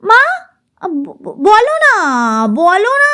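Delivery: high stretched whine; drawn-out near-crying plea. Acted. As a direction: drag out the words, nearly in tears. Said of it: "বলো নাটাকে অনেকখানি টানতে গিয়ে রুনা প্রায় কেঁদেই". (প্রায় কাঁদো কাঁদো টেনে বলা)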